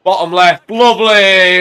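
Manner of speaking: loud, high cheer; goal celebration. Real, not acted. Gamer cheers his penalty going in, excited shout.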